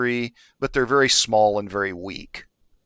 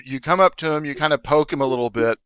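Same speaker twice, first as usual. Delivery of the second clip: ordinary talk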